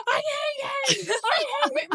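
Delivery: imitating a TikTok sound, high-pitched shouting; TikTok trend sound. Telling her bestie about a TikTok sound, high mock shriek. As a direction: shriek it like the viral clip. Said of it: "the sound is like".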